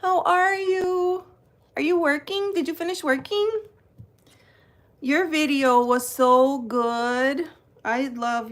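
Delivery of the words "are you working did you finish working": usual speaking voice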